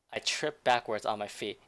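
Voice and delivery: ordinary talk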